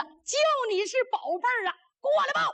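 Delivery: high, eager shout to a prized quarry; gleeful taunting call. A narrator's reading. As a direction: call the enemy over, pitched very high. Воин окликает ценную добычу.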